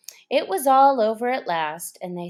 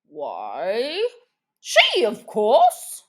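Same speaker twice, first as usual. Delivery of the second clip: dodo voice; 5 dB louder, much slower than usual. A narrator's reading. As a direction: state it grandly, pointing at her. Dodo answers.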